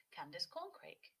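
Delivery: usual speaking voice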